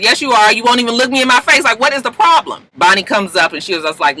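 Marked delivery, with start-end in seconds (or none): 0.0-2.7 pushy confrontation. Retold or imitated. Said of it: "She says".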